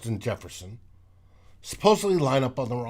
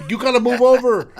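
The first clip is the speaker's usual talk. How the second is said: mock yell; 8 semitones higher, 7 dB louder, faster than usual. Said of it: "Alexander Madison goes".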